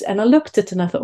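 ordinary delivery

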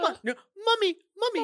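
put-on high squeaky child calling mommy; whiny little girl chasing her mom. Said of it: "little girl running around being like".

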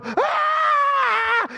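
long, high cheering scream; mock parent scream. Parents when the kid's name is called, mock scream. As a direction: imitate a parent shrieking at graduation. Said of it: "and the parent would go".

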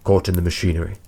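usual speaking voice

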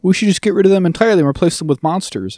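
high-pitched, put-on reasoning; mock bad-faith argument. Co-host parodying a bad-faith take on representation, mockingly high. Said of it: "It's that kinda thing of".

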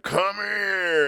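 loud, high come-here call. Acted. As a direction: call her across the room. Acted friend calling a girl over.

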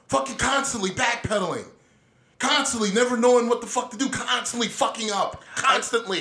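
each "Constantly" punched hard with a falling tune; hammering repetition. Real, not acted. Ranting about the Democrats, hitting "constantly" hard. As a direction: pound the word "constantly" every time.

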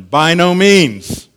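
high, loud, drawn-out "By no means"; emphatic answer. Acted. Preacher quoting Paul's answer to the objection, loudly emphatic "By no means". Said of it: "gives an emphatic answer".

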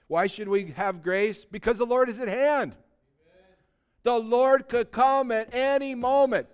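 loud, high, driving sermon voice; fired-up preacher calling out the older folks; preaching at full force. Acted. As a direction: pound it home to the congregation.

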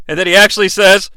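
usual speaking voice

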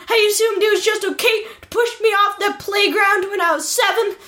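high, hurt mock tantrum; mock wounded outburst. Guest accuses interviewer of a playground shove, mock wounded. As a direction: build into a hammed-up tearful accusation.

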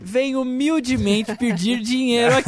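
laughing. Real, not acted. Jokingly asking host for money.

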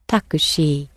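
"takushi" ending in a "she" sound; Japanese pronunciation. Shown as a demonstration. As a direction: finish "takushi" on a clear "she". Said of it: "you've got a she at the end".

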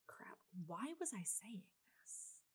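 a quick puzzled whisper; whispered aside. Real, not acted. Vlogger forgetting her point, whispering to herself. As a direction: whisper it to yourself, unvoiced.

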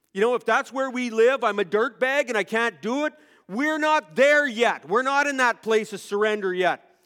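loud, high, driving preacher's cry; preaching at full force. Acted. Pastor to congregation, preaching at full force about Isaiah. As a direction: proclaim it to the whole room.

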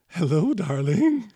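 lightly voiced sultry greeting; femme fatale. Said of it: "you hear a voice say".

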